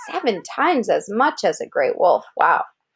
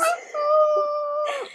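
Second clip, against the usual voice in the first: laughing; 3 dB quieter than usual. Real, not acted. Laughs after the howl.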